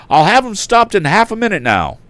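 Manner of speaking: high loud call. A narrator's reading. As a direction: call it while holding on. Struggling with horse.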